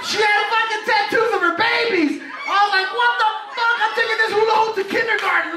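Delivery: high-pitched comic outrage; mock freak-out. Retold freak-out over baby tattoos.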